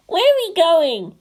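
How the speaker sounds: put-on high, slow child voice; little girl asking. A narrator's reading. Nozomi asks Toji where they go, high child voice. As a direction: ask it like a wary kid. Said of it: "Nozomi asked".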